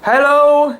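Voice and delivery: high, loud hail into the dark; calling across the dock; shouting. A narrator's reading. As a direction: shout out to find him. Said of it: "we shouted".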